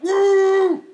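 very high, loud woohoo; joyful whoop. Retold or imitated. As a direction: whoop with wild joy. Rejoicing while crashing.

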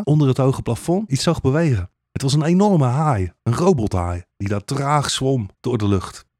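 ordinary delivery

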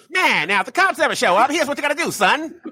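high, coaching dad voice; a father egging his son on; old-timey dad. Retold or imitated. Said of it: "dads were like".

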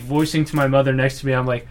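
normal talking voice

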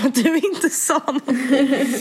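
a slow, laughing question; half-laughing disbelief; laughing through it. Retold or imitated. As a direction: let the laugh leak through the words. Reading her own message with a laugh.

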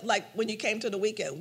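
ordinary talk, nothing marked

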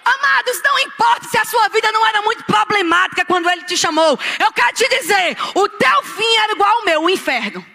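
high, forceful preacher's cry; shouted exhortation. Real, not acted. Preacher warning beloved congregation about their end, shouted exhortation. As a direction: call out urgently to the whole congregation.

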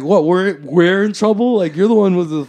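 loud, high, incredulous comeback; worked-up retold outrage; indignant protest. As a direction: act out arguing back at the cops. Acted protest at the cops.

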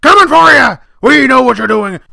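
high, full-force shouted tirade; worked-up bellowing; fired-up rant. Acted. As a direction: yell like a paranoid radio host rallying against the enemy. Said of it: "I just get fired up".

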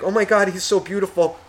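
high-pitched cooing over a newborn; gushing relative. Retold or imitated. Grandmother at the newborn's bedside, gushing.

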